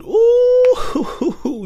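long, very high-pitched ooh; exaggerated ooh. Real, not acted. Host dreading a narcissist's bad mood, high drawn-out ooh. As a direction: let out a high warning whoop.

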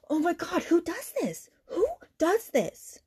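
high-pitched, aghast outburst; high, incredulous exasperation; outraged disbelief. Real, not acted. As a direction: demand it in appalled disbelief, slowing on the repeat. Reacting aghast to the husband's behaviour.

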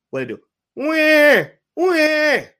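put-on high, slow, loud baby wah; mock baby wailing. Mimicking a sad baby's crying for his audience. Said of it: "What do babies do when, when babies are sad".

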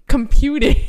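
loud, high imitation of someone processing; mock blank computing. Co-host imitating friend staring blankly, mock computing. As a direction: play a brain stuck computing. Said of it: "she just looked at me blankly".

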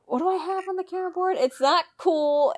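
high mock outrage; mock indignant. Reading the Karen board.

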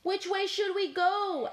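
high, eager question; Cat. Acted. Cat asking Fork the way, high and eager. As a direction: ask it brightly up high. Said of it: "asked Cat".

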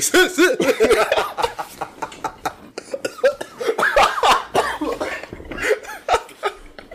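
high loud burst of laughter; cracking up at his friend's clout claim; big laugh. Real, not acted. Friend laughs hard at the clout claim, loud high laughter.

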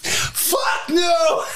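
put-on high shouted Scottish accent; Robin Williams' Scottish. Robin Williams' golfer, still in a shouted Scottish accent. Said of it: "you doing the Scottish accent".

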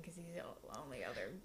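soft conspiratorial whisper. Real, not acted. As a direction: drop to a whisper for the aside. Whispered aside about predators.

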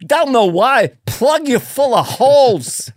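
high, loud, baffled Walken killer; Walken hitman. Pretend. Comic pitching Walken as a hitman, high and loud. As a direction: menace them like a puzzled Walken hitman. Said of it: "hitman that talks like that".

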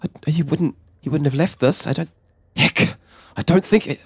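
high, fast, stammering worry; worried character voice. Acted. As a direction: stammer it out, scared for a friend. Trevor fearing friend is gone.

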